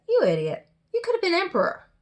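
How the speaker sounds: exasperated queen, jumping high on the complaint; scolding wife. Acted. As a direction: berate the husband, pitch swooping up. Queen wife scolds him in story.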